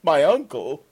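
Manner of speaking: sudden alarmed query; surprised. Acted. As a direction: caught off guard by the uncle. Surprised question in the cell.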